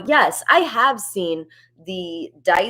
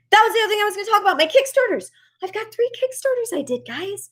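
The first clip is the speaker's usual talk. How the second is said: excited rush; 13 semitones higher, faster than usual. Real, not acted. Suddenly remembering her Kickstarters.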